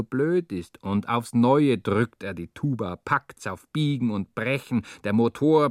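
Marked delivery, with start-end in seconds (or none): none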